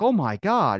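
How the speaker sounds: hammed-up horror; mock scandalized. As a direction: play prim onlookers, shocked. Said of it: "bunch of people clutching their pearls".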